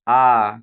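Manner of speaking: आ held long and loud; long vowel demo. Shown as a demonstration. Teacher showing children the long vowel, loud and drawn. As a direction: stretch आ out for the class. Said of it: "आ देरी लग रहा है".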